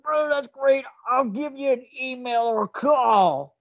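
high, slow gravelly wrestler promo voice; over-the-top wrestling hype voice; Macho Man impression. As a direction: ham it up like Macho Man Randy Savage. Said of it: "gonna do a bad Macho Man impression".

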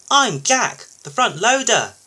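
high, loud, proud little loader; chest-out introduction, pitched right up. A narrator's reading. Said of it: "he whirred proudly".

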